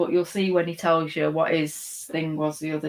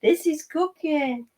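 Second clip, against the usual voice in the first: doting pet voice; 7 semitones higher than usual. Real, not acted. Host introducing the dog to viewers, doting. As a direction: coo proudly over the dog.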